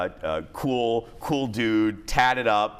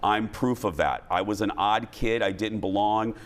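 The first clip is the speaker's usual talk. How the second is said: sincere; 6 semitones lower, 3 dB quieter than usual. Real, not acted. Sincere message to bullied kid.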